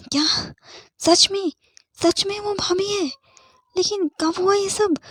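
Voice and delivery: high-pitched, disbelieving surprise; astonished questions. A narrator's reading. Friend learns she is his sister-in-law, astonished. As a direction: fire off startled questions, voice jumping up. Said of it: "एक ही सांस में".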